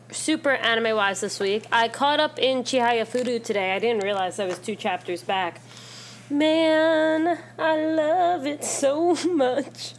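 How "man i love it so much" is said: gushing. Real, not acted. Gushing about favorite manga.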